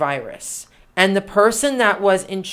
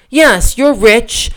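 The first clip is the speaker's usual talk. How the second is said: usual speaking voice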